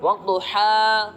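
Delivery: slow, drawn-out ہا at the stop; pausal demo. Shown as a demonstration. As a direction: stretch the ہا slowly as you stop. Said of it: "کیسے؟".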